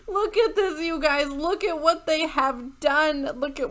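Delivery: half-sobbing with laughter at the shirt; laughing disbelief. Real, not acted.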